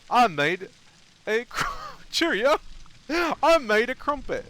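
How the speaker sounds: high, loud mock British voice breaking into laughter; British dude. Streamer acting the British crumpet inventor, hammed-up British. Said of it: "British dude in America was like".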